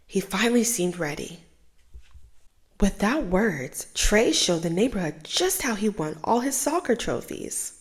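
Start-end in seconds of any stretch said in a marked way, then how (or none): none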